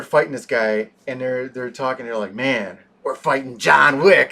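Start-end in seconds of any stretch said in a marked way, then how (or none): 2.3-4.3 gleeful, loud mock henchmen; loud gleeful fans mid-fight; excited fighters. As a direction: hype it up like thrilled thugs. Excited fighters retold in movie.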